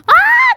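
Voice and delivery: very high, loud shriek; scream. Pretend. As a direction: let out a piercing squeal. Scream in game.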